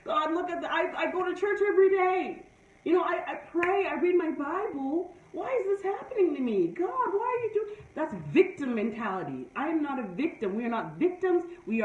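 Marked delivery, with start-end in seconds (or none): 0.0-7.8 imitating a pleading, complaining prayer, higher pitch; mock whiny prayer. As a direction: whine to God like a self-pitying victim. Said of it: "going and crying to God and saying".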